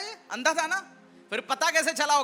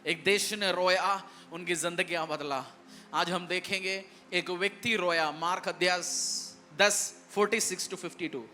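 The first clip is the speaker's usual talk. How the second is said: soft sermon intro; 8 semitones lower, 7 dB quieter than usual. Acted. Quiet reading of scripture reference.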